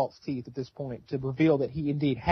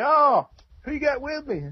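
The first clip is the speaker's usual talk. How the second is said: hillbilly uncle; 11 semitones higher than usual. Retold or imitated. Voicing Uncle Terry.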